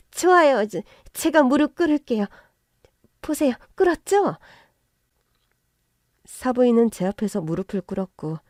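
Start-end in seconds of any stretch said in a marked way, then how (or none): 0.1-4.4 high, loud and rushed, stumbling over the words; panicked groveling, begging to be let off; frantic pleading. Acted. Mother-in-law kneeling and begging, high frantic plea.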